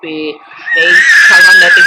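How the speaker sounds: shouting out the recap, fired up; loud rant. Real, not acted. Vlogger shouting to viewers about the fight, loud rant. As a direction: yell it like you can't believe it.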